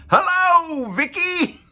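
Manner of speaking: high, loud shout. A narrator's reading. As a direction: yell it far up in pitch. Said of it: "shouted about as loud as she could".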